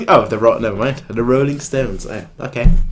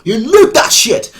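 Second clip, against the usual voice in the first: excited; 10 semitones higher than usual. Real, not acted. Excited answer, big pitch jump.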